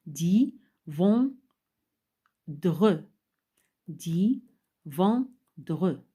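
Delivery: "di", "von", "dre" loud, each apart; syllable by syllable. Shown as a demonstration. Teacher showing three syllables to order, "di", "von", "dre" said one by one. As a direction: sound out "di", "von", "dre" with a pause after each. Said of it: "se compose de trois syllabes".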